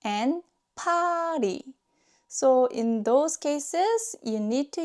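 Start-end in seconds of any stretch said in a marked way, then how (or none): 0.8-1.6 팔이 linked as pa-ri, higher pitched; linked pronunciation. Shown as a demonstration. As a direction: carry the ㄹ of 팔이 onto the final vowel. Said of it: "they are naturally pronounced as".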